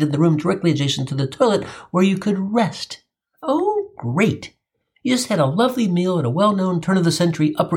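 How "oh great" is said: high, sarcastic "oh great"; mock delight. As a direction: fake cheer. Mock delight at restroom couch.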